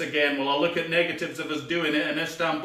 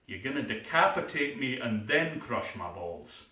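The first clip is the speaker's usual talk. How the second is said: normal talking voice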